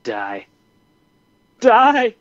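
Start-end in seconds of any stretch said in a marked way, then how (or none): 1.6-2.1 "Die." shouted much higher and louder; hammed-up yell of "Die.". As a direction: bark "Die." at the running buck. Willing the shot buck down.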